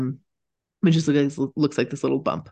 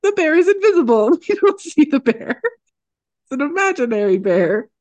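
silly mock voice; 11 semitones higher, 4 dB louder than usual. Telling friends what the animals seem to think, high and goofy. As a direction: ham up a daft inner voice. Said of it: "They're just like".

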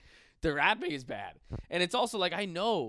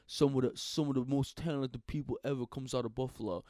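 lower, slurred, lopsided-mouth rapper voice; 4 semitones lower than usual. Retold or imitated. Comic mimicking a Buffalo rapper, slurred and lower. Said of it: "he talks like this".

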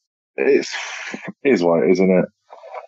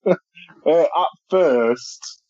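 nervous laugh; 10 semitones higher, much slower than usual. Real, not acted. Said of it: "that's a nervous laugh".

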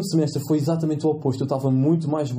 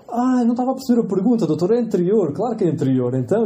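sudden eureka; 6 semitones higher, 4 dB louder than usual. Retold or imitated. Student to examiner, suddenly getting the question, excited. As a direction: pitch jumps up, louder, relieved burst. Retelling sudden realisation in oral exam.

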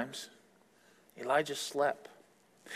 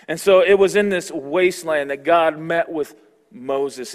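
normal talking voice

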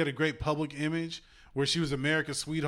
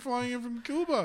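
higher-pitched mock star voice; 9 semitones higher than usual. Co-host mimics singer turning down street dealer, high mock voice. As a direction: play the star grandly waving off the idea, pitched up. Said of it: "[name] was like".

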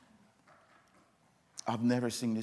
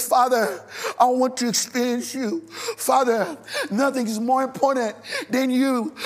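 loud, high pleading prayer with the voice breaking; crying prayer; 13 semitones higher, 13 dB louder than usual. Acted. Preacher voicing Jesus praying to the Father, tearful loud plea. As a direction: cry out to the Father through tears.

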